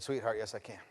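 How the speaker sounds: low, soft promise; tender resolve. Retold or imitated. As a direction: answer her quietly and low. Father's changed answer to daughter.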